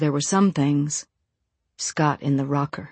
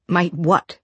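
angry shaky voice; 2 semitones higher, 3 dB louder than usual. Acted. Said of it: "in an angry, shaky voice".

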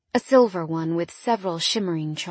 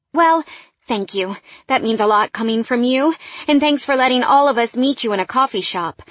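higher, louder, eager thanks; grateful employee; 4 semitones higher, 5 dB louder than usual. A narrator's reading. Employee thanking boss for the coffee shop meeting, grateful. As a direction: gush politely to the boss.

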